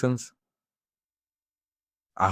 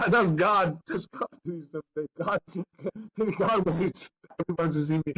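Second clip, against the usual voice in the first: high, broken laughter between phrases; giggly and weepy at once; laughing through tears; 8 semitones higher, 5 dB quieter than usual. Real, not acted. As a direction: squeeze the words out through tearful laughs. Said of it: "then we start laughing again".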